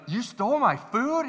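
high-pitched mock outrage; indignant retold question, voice leaping up; accusing customer. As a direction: imitate an incredulous accusation. Retelling customer accusing another customer.

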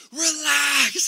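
high, strained holler with a falling end; imitated coach yelling. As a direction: yell it like a tense coach from the bench. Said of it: "he starts yelling from the bench".

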